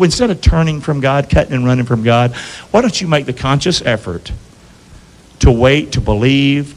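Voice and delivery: usual speaking voice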